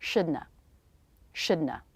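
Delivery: shouldna said SHOOD-nuh; casual shouldna demo; reduced shouldna. Shown as a demonstration. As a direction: drop not, just an N then schwa. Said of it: "release the tongue to make the schwa".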